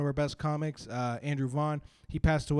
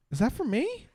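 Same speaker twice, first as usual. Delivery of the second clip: higher, coy question; cop's sly question; 8 semitones higher than usual. Retold or imitated. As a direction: ask it sweetly, pitched up. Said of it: "looks up at me and goes".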